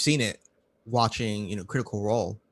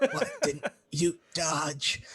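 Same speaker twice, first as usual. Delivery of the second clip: slow, higher, through laughter; laughing; 7 semitones higher, much slower than usual. Retold or imitated. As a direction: laugh while asking. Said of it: "party reaction".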